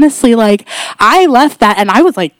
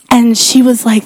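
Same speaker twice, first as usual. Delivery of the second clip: ordinary talk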